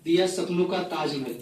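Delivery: phrase-by-phrase mushaira delivery; poetry recitation. Acted. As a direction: recite each couplet to the audience with pauses. Recites a poem to audience. (मुशायरे के अंदाज़ में कविता पाठ)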